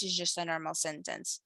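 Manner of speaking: normal talking voice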